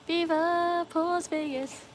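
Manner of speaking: high, slow drawn-out chant of the slogan. Shown as a demonstration. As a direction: stretch out the catchphrase like a showman. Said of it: "I just can't do it as good as you".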